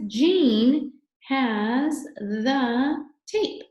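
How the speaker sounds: "Jean has the tape" very slow and drawn out, word by word; teacher sounding out a sentence slowly; slow model reading. Shown as a demonstration. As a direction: read each word loud, high and stretched, with gaps. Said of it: "It says".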